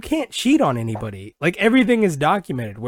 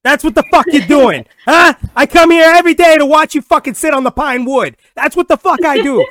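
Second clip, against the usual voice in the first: loud, high mock tirade; angry sports dad; 11 semitones higher, 11 dB louder than usual. Dad berating his kid on the field, hammed-up fury. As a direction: rant like a dad yelling from the sidelines.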